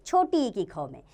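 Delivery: normal talking voice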